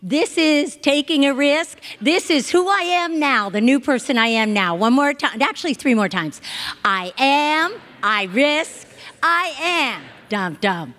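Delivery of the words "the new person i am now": ordinary talk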